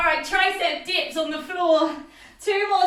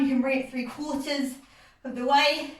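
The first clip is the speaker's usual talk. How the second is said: usual speaking voice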